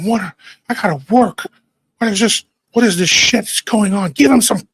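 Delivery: grouchy high-pitched dad without his dentures; toothless dad. Retold or imitated. Dad roused with teeth out, exasperated toothless griping. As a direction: complain with a mouth missing its teeth. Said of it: "his teeth aren't in".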